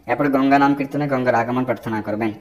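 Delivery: usual speaking voice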